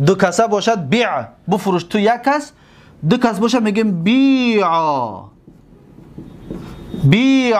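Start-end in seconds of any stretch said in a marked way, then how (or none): 4.0-4.7 بیع drawn out as bii'aa; ya returns. Shown as a demonstration. Teacher giving the form for two people, ya restored. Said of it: "یا برگشت". 7.0-7.6 بیع high, held as long bii'aa; ya returns. Shown as a demonstration. Teacher repeating the dual, ya restored and long. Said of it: "یا برگشت".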